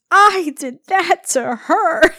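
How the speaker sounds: slow, high, loud mock wail; hammed-up horror, every word drawn out; horrified admission. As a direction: wail it slowly as it hits home. Retold horrified admission, exaggerated.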